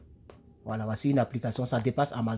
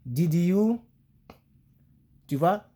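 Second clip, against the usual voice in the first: usual speaking voice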